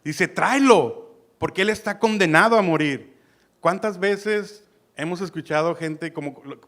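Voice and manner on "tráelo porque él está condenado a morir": orden fuerte de rey. Retold or imitated. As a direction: manda traerlo, alzando la voz. Saúl ordena matar, cita. (orden tajante del rey Saúl)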